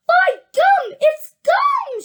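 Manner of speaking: very high, loud yell of excitement; excited shriek. A narrator's reading. Gum-chewing girl spots the gum, excited shriek. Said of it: "let out a yell of excitement".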